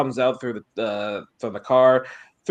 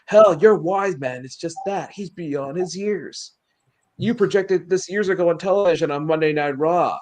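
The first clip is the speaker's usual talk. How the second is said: a touch of impassioned promo delivery, slightly raised pitch; lightly voiced wrestler promo; 6 semitones higher than usual. Wrestler reminding Paul of old promises, lightly acted promo. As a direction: lightly act out a wrestler's appeal.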